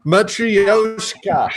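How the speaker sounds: slow, drawn-out "Matryoshka"; careful imitation. Shown as a demonstration. Co-host copying the played pronunciation, slow careful "Matryoshka". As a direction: stretch "Matryoshka" out, copying the recording. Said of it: "listen really carefully".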